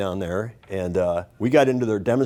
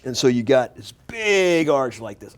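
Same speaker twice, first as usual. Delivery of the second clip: ordinary talk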